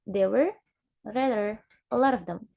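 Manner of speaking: There were, lifted, then rather a lot of them linked; native-style weakening. Shown as a demonstration. Teacher imitating native speakers, There were rather a lot of them in linked chunks. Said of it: "they will say like this".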